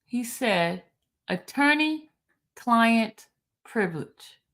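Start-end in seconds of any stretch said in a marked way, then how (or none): 1.3-4.4 "Attorney-client privilege" spaced out word by word, as a statement; slow reading. Shown as a demonstration. Host spelling out the quote for slow listeners, slow and deliberate. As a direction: read "Attorney-client privilege" slowly, pausing between words. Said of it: "I said I was gonna read it slow".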